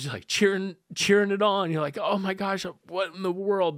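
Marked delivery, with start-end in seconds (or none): 2.0-3.8 high-pitched wonder without extra volume; amazed. Retold or imitated. Family cheering at the news, amazed. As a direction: marvel at it, pitch lifted.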